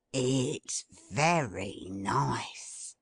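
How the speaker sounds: slow, low, savouring sniff-talk; savouring Toby. A narrator's reading. Toby savours the smell from the basket, slowly. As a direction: relish the smell. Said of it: "inhaling laughing gas".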